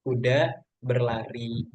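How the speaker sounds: slow, level reading with no sentence ending; slow example read. Shown as a demonstration. Teacher reads example clause slowly to students. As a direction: lay out the example clause unhurriedly. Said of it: "Intinya gitu, teman-teman".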